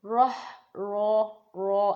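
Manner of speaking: راہ with a full, heavy ر and open vowel. Shown as a demonstration. Teacher showing students a heavy را sound. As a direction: say را slowly and heavy, then repeat it. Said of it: "موٹا ادا کرنا ہے".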